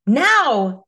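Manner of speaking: loud, high, sudden shout; urgent yell. A narrator's reading. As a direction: yell the order as a desperate mother. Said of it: "she yelled".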